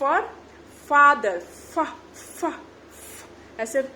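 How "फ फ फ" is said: फ as short airy puffs; blowing out air. Shown as a demonstration. Teacher sounding F for father, airy separate फ sounds. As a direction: release each फ like letting air out. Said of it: "हवा निकालने जैसा".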